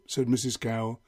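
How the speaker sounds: ordinary delivery, nothing marked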